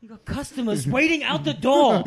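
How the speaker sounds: furious chef. Retold or imitated. Ramsay scene continued.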